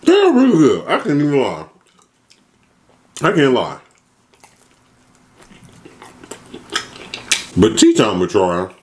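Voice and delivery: speaking between bites; talking while chewing. Real, not acted. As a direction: mouth full of pizza. Seen 0: bites pizza at -12 and 8; talks with food in mouth.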